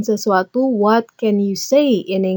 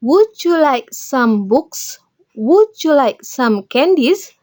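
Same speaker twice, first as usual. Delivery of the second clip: slow, clear teacher model of each question; slow model sentences; 3 semitones higher, much slower than usual. Shown as a demonstration. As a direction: spell it out slowly for learners. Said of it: "kalian pakai ini". (contoh kalimat diucapkan pelan dan jelas)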